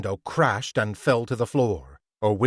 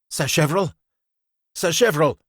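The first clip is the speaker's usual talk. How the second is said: higher, louder calling out; calling through door; 6 semitones higher, 5 dB louder, faster than usual. A narrator's reading. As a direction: call his name anxiously. Someone calls through door.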